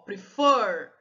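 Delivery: "prefer" with the second syllable drawn out strong; stress on final syllable. Shown as a demonstration. Teacher showing the class the strong syllable, "prefer" stressed on "fer". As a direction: lean slowly on the "fer" in "prefer". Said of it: "a sílaba forte é o for".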